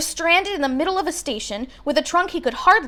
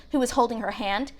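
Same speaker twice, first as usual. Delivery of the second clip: usual speaking voice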